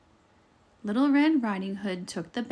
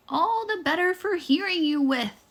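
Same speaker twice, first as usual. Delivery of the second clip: put-on much higher wobbly old-lady voice; wolf faking a frail grandma; wobbly fake grandma; 9 semitones higher, 4 dB louder than usual. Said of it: "in the same wobbly voice".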